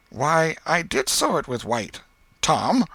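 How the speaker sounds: aunt slyly questioning nephew Tom about school; Aunt Polly. A narrator's reading.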